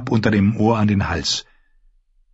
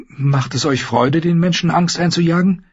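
put-on higher, fast girl's voice, defensive; young lady insisting she wasn't scared, pitched up; frightened Sansa; 7 semitones higher than usual. Sansa denying her fear to the Hound, high and quick.

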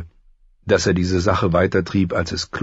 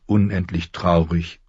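plain reading voice

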